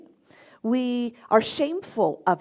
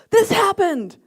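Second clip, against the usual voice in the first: loud, high angry announcement; acted-out yell at a daughter; retold yelling; 10 semitones higher, 7 dB louder than usual. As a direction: blurt it out heatedly. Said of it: "You're yelling at me".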